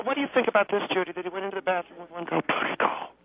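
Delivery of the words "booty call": unvoiced hushed whisper; whispering on the phone. Real, not acted. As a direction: whisper it so nobody hears. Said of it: "Why are you whispering?".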